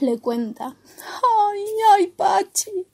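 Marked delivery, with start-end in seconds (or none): 1.5-2.9 sobbing, high-pitched storybook character; tearful, higher-voiced confession; Opu. A narrator's reading. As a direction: whimper it through acted sobs. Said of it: "entre sollozos".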